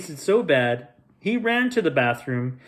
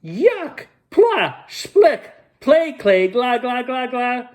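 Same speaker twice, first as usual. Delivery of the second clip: much higher, louder shrieks of disgust; disgusted yell; 10 semitones higher, 5 dB louder, slower than usual. A narrator's reading. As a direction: spit it out like a kid tasting clay. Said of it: "And he yelled".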